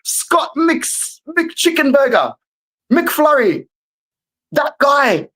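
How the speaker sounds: high-pitched comic fury at a footballer; exasperated rant. Real, not acted. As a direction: whine about him like he ruined everything. Fan ranting about rival's goals.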